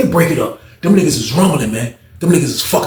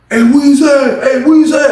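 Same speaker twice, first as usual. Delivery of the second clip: much higher, louder call; 13 semitones higher, 6 dB louder, slower than usual. Retold or imitated. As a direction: call through the cell hole. Calling out from cell.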